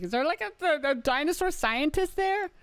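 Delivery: put-on very high little girl voice; squeaky-high put-on kid question; imitated daughter. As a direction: mimic a curious child asking up high. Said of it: "She was like".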